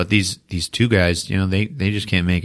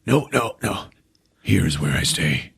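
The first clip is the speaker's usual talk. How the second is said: dying refusal; 2 semitones lower, 5 dB quieter than usual. Retold or imitated. Dying man refuses help.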